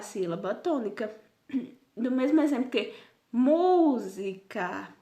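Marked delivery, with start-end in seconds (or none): 3.3-4.9 "música" drawn out slowly, mu - si - ca; stressed-syllable demo. Shown as a demonstration. Teacher showing pupils the tonic syllable, "música" slow with stressed mu. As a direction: stretch "música" by syllables, weight on the first, mu. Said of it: "Então, mu".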